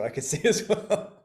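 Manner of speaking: laughing. Real, not acted. Laughing at Zoom effects.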